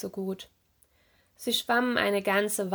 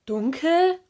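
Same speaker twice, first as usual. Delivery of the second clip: high, loud, rising echo question; incredulous; 7 semitones higher, 5 dB louder than usual. A narrator's reading. Lanternfish doubting that it is dark, incredulous. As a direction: throw the word back in disbelief.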